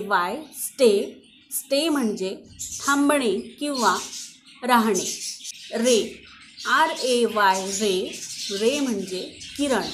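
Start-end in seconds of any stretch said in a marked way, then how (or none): none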